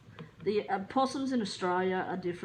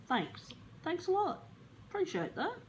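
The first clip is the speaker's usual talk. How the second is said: sarcastic thanks; 10 semitones higher, 3 dB quieter than usual. Retold or imitated. Sarcastic reply to real estate.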